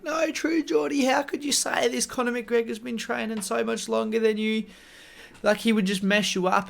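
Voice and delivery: high-pitched imitation of outraged fans; mock freaking out. Online fans protesting to True Geordie, mock freak-out. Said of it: "freaking out".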